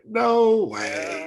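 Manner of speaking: high, loud retold disbelief; amazed. Retelling the guess that proved right, amazed. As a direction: act out a burst of disbelief. Acts out his amazed reaction.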